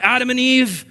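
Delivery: loud, high, angry summons; harsh stern father. Pretend. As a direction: call them out like a furious judge. Angry God calling for punishment.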